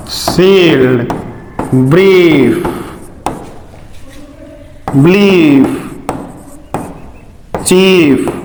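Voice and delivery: सील्ड, ब्रीफ, ब्लीफ, चीफ one by one, ee drawn out; isolated model words with a lingering ee in सील्ड, ब्रीफ, ब्लीफ, चीफ; long ee. Shown as a demonstration. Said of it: "capital E ही होता है".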